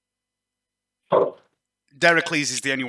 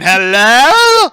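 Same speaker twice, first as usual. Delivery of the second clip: very loud, high-pitched hello; calling hello; 12 semitones higher, 15 dB louder than usual. Acted. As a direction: blare it out from right behind someone. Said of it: "stood right behind Ishmael going".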